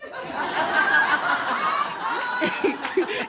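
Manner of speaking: real high-pitched laughter. Real, not acted. Laughing at own joke.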